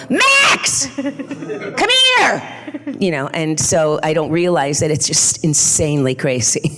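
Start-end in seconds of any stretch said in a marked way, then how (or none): 0.1-3.0 calling out to her son. Retold or imitated. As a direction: yell for Max like a mother who wants him now. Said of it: "you know, I'll go".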